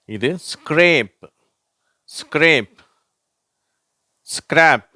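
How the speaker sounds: ஸ்க்ரேப் and ஸ்கிராப் said high, loud and clear; teacher modelling scr words; model words scrape and scrap. Shown as a demonstration. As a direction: contrast the vowel in ஸ்க்ரேப் and ஸ்கிராப். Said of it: "வார்த்தைகளை பார்ப்போம்".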